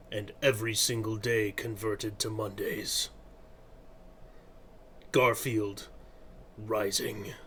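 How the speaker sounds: low, soft, slow movie trailer voice; hushed epic trailer announcer; trailer narrator. Acted. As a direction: intone it like a dramatic trailer voiceover.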